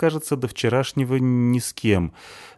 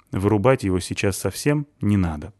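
plain reading voice

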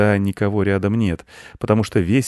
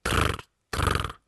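two short rolled trills; beetle trill; 6 dB quieter than usual. Acted. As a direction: buzz twice like an insect. Beetle answers no.

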